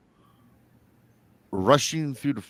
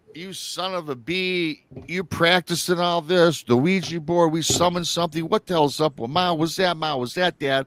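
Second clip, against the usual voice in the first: retold riot act, pitched up; pissed off; 5 semitones higher than usual. Jeremy grabs his brother over the Ouija board, pissed. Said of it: "He's so pissed".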